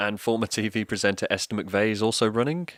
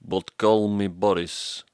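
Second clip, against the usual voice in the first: mock Russian accent, slow at the end; Russian-accented villain intro; 3 semitones higher, 3 dB louder than usual. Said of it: "sounds pretty Russian when said with an accent".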